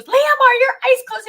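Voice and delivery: high, loud screamed question on a ride; retold scream. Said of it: "And, and she goes".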